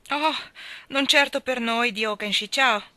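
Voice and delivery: loud, boastful booming; a loud, swaggering boast; thundering. A narrator's reading. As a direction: boom it out like a braggart. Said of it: "tuonò Myrtleton".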